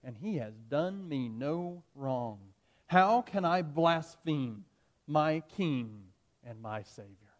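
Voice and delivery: very slow, deliberate words; slow solemn conviction. Acted. Polycarp refusing to blaspheme his king, slow and solemn. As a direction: let each word land gravely. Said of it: "Polycarp declared".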